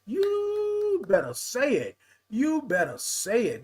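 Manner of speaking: YOU held long and high; slow, high-swooping approval; emphatic hype. Real, not acted. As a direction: cheer them on with a soaring, stretched YOU. Hyping up a listener's comment.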